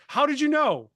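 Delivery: high, loud astonished comeback; retold surprise. Stranger answering the question about coach training, surprised. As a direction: mimic someone caught out and amazed.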